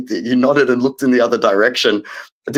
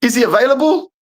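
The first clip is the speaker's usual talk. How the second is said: much higher, strained mock-outrage; high-pitched comic fury at a footballer; exasperated rant; 13 semitones higher than usual. Real, not acted. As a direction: whine about him like he ruined everything. Fan ranting about rival's goals.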